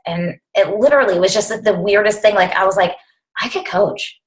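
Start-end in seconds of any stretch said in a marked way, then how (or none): 3.4-4.2 breathy realization. Retold or imitated. Sudden idea quoted to herself.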